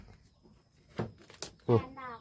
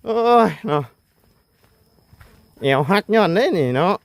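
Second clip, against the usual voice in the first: strained groan; 5 semitones higher, 4 dB louder than usual. Real, not acted. Back strained after lifting.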